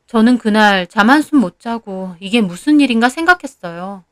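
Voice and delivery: ordinary delivery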